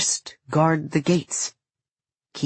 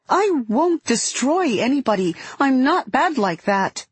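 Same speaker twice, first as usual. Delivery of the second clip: put-on high-pitched child protesting; upset child; 9 semitones higher than usual. A narrator's reading. Girl denies to her guardian that she's bad, upset. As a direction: burst out in a much higher child's voice. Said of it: "Suddenly it's too much".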